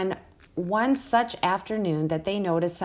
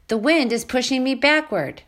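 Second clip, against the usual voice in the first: struggling gosling; 7 semitones higher, 3 dB louder than usual. A narrator's reading. Gosling struggles against wind.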